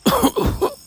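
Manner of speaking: loud, high hacking cough; acted cough. A narrator's reading. Wounded grandfather trying to speak, acted coughing. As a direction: cough hard as the injured man. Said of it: "He coughs".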